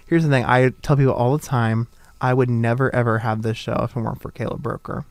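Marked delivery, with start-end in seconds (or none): none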